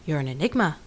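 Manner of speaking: hushed, moonstruck repeat; dreamy echo. Acted. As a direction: savour each word like a gift.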